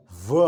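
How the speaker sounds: V as "vuh", not the letter name; isolated "vuh" for V; voiced consonant demo. Shown as a demonstration. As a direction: sound out V with a short vowel after it. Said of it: "V is voiced".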